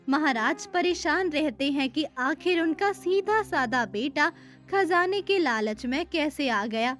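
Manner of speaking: usual speaking voice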